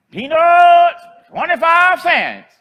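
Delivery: high, loud, drawn-out peanut seller's call; street vendor's cry. Retold or imitated. Old lady selling peanuts calls to passing traffic, loud high street cry. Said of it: "she called out".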